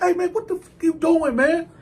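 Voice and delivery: high, incredulous challenge; indignant. Retold or imitated. Man confronting his exposed bunkie, indignant. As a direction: challenge him in a high, appalled voice.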